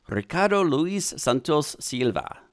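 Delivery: name said in a put-on Spanish accent; slow, showy Spanish accent. Shown as a demonstration. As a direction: do your best Spanish accent, drawn out slowly. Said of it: "Spanish accent".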